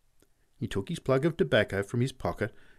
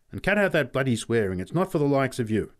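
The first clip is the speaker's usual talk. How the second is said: scolding; 4 semitones higher, 6 dB louder than usual. A narrator's reading. Pat repeats his scolding at parting.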